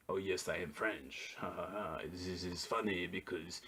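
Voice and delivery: low, soft, hammed-up French accent; mock French accent. Host mocks the ad's idea of French speech, put-on French accent. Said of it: "this is how French people speak".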